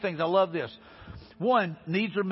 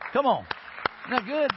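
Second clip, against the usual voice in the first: rousing; 5 semitones higher than usual. Real, not acted. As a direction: lift the room with it. Preacher rallying the church.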